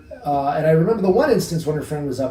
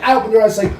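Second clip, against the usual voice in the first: ordinary talk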